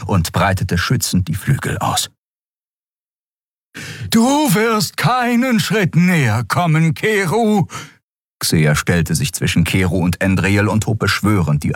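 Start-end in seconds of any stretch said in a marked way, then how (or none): 4.1-7.7 very high, slow character voice; threatening old woman. A narrator's reading. Old Xea blocking Keru from Andriel, threatening. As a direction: warn him off, pitched way up and drawn out.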